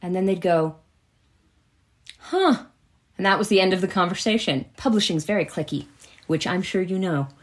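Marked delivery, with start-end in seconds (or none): 2.2-2.6 high, flat "huh" of lost interest; a bored little huh, pitched up; mock uninterested grunt; dismissive huh. Dismissive publishing reply.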